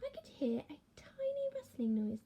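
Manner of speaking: ordinary delivery, nothing marked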